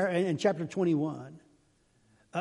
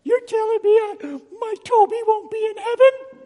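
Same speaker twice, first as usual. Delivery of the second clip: much higher, loud wailing parishioner; hammed-up dismay over her cat Toby; high mock-wounded protest; distraught cat owner; 9 dB louder than usual. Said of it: "Started getting emails and comments".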